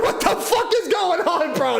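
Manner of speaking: high, laughing mock disbelief; a hammed-up laughing complaint; laughing outrage. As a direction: laugh while asking what's going on. Retold reaction to buddy leaving.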